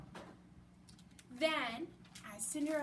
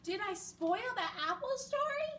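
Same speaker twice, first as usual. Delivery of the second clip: higher, blurted out in a rush; 7 semitones higher than usual. A narrator's reading. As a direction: burst out with the worried question. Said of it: "he blurted".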